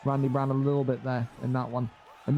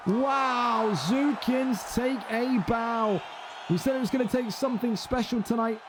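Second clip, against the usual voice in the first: ecstatic play-by-play; 6 semitones higher than usual. Acted. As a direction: sell the Kimura reversal like a title moment. Submission reversal, big excited call.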